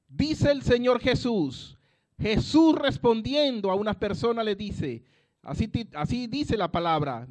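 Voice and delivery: loud, driving preacher's proclamation; fervent loud sermon voice; full-force preaching. Acted. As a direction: preach it out to the congregation.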